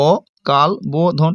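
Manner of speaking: অকাল বোধন said very slowly, syllable by syllable; slow syllable split. Shown as a demonstration. Teacher splits the word for students, very slow. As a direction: draw out অকাল বোধন to show each syllable. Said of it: "দল বিশ্লেষণ করি অকাল বোধন".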